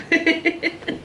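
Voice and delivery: high short laugh; quick giggle; giggling burst; laughter. Real, not acted. Laughs during accent talk.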